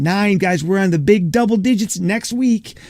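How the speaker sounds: high, thrilled announcement; excited. Real, not acted. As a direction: hype up the milestone. Celebrating reaching episode ten.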